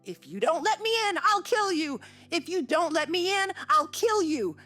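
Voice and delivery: high, fast, frantic shriek; boy shrieking at the door he cannot open, high and frantic. A narrator's reading. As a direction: scream the threat at the locked door. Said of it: "he rather shrieked than said".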